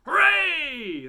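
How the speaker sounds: loud high hooray; cheering. A narrator's reading. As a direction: shout it with glee. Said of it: "they said".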